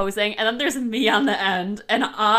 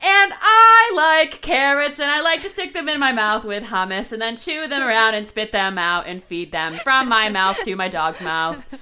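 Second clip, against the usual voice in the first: comic misfit sketch character; 2 semitones higher, 7 dB louder than usual. Acted. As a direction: proudly announce your gross hobby, hammed-up. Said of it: "made me think of the S N L skit".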